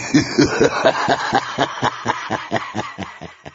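long high villain laugh, dying away; horror-film cackle that slowly fades; staccato maniacal laughter; evil cackle. Acted.

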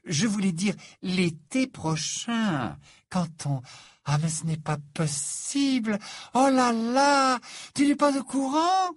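astonished, climbing high and loud; gush, then exclaim in disbelief; drawn-out, theatrical surprise; effusive young Frenchwoman. Acted. Fleur's character voice.

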